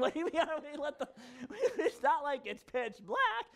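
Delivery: fast words spilling out through laughter; laughing. Real, not acted. Pastor laughing at the visitors' question, amused disbelief. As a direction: laugh in disbelief as you talk.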